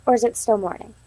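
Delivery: ordinary delivery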